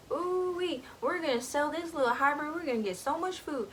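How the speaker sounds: high, quick mock country taunt; taunting country hunter. Hunters taunting the captured Gus, in a high country accent. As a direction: crow over the prize in a high country accent. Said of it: "taunt him and stuff".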